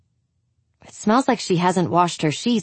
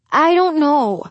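defensive; 9 semitones higher, 4 dB louder than usual. Acted. Angie defensive, pressed hard.

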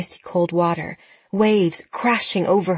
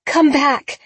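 high, loud desperate call; crying out; 8 semitones higher, 5 dB louder than usual. A narrator's reading. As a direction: shout it after the boat. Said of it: "Jenna cried".

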